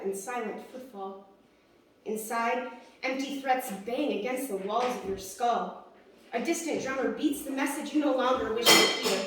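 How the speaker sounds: measured, dramatic recital that swells into urgent peaks; rhythmic poem delivery, building and easing; spoken-word poetry. Acted. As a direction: perform it like slam poetry, pausing between images. Performs own spoken-word poem.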